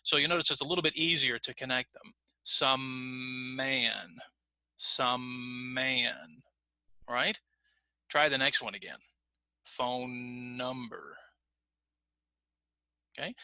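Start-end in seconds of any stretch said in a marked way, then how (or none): 2.4-6.4 SOME MAN joined by one long hummed M; stretched M. Shown as a demonstration. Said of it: "are easier to stretch together". 9.6-11.2 PHONE NUMBER joined by one long, soft N; stretched N. Shown as a demonstration. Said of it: "are easier to stretch together".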